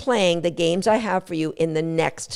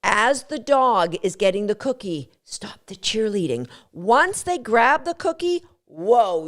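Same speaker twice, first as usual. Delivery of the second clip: usual speaking voice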